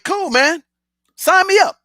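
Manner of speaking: retold eager acceptance, pitched high; eager yes. Salesman to his boss accepting a big offer, eager.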